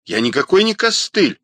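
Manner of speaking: high-pitched indignant denial; flaring up. A narrator's reading. Rodionov rejects the nickname, indignant. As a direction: push the pitch up in protest. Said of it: "взвился Родионов".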